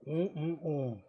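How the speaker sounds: humming mm of delight; savoring hum while tasting. Real, not acted. Tasting the steak.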